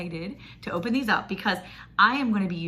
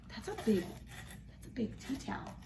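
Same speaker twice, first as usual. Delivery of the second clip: soft, slow whisper of awe; 3 semitones higher, 12 dB quieter than usual. Real, not acted. Showing viewers how big the towel is, whispering in awe. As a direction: hush it, amazed at its size.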